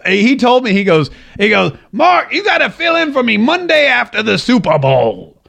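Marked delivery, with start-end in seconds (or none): 1.9-5.4 Herman Cain impression. Radio host mimics Herman Cain asking him to fill in, hammed-up impression. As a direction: call it out in a big, high-pitched put-on voice. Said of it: "Because that's how he talks".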